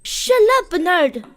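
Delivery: high, loud, furious cut-in; angry outburst. Acted. Embarrassed woman tells Bernard to stop, angry shout. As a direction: silence him with a sharp shout.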